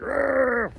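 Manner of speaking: high, drawn-out growl of frustration; about-to-explode growl. Retold or imitated. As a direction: imitate a kid ready to explode, high-pitched. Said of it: "just about to explode".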